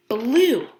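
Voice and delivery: ordinary delivery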